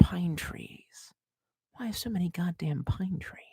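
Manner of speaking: soft slow whisper. Real, not acted. Streamer griping about pine trees, whispering. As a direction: breathe the complaint under your breath.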